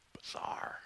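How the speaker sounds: a breathy stage whisper on one word. Acted. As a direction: lean in and whisper it like a secret. Dramatic whispered punchline.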